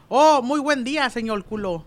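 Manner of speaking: high-pitched mock Chinese accent, r turned to l; chirpy foreigner greeting with a Chinese accent. Chinese man greets the torero with a mock Chinese accent. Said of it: "era el mismo saludo".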